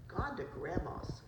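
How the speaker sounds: ordinary delivery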